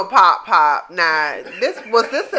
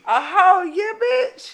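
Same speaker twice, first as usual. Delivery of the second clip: shocked disbelief; 11 semitones higher, 3 dB louder, slower than usual. Real, not acted. Incredulous outburst at story.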